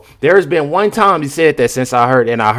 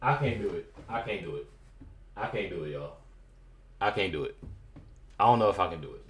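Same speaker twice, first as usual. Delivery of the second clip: overwhelmed; 5 semitones lower, 15 dB quieter than usual. Real, not acted. Overwhelmed, breathless reacting.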